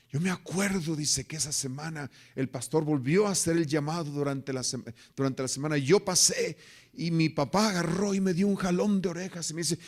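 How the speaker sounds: loud, high fervent preaching; preaching at full force. Acted. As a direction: drive the story home to the pews. (predicación ferviente y fuerte)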